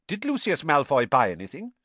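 sharp. A narrator's reading. Mister Weasley questions Harry from behind, sharp. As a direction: cut in sharply. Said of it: "said Mister Weasley sharply".

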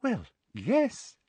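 high, soft, smug coo; smiling sweetness. A narrator's reading. Umbridge confirming the new decree, smiling sweetness. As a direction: answer softly and high through a smile. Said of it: "still smiling".

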